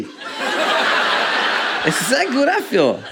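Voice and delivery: laughing at the memory while telling it. Real, not acted. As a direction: laugh your way through the line. Laughing recalling her rejection.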